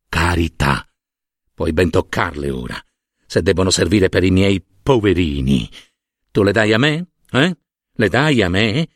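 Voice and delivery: low, coaxing priestly voice; unctuous monsignor. Acted. Monsignor taking the bills for his poor, unctuous. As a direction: drop the pitch and coax, slyly assured.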